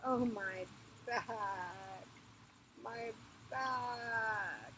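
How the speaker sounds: slow, drawn-out moan of pain; queen moaning slowly in her labor pains; moaning and groaning. Acted. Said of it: "She was moaning and groaning".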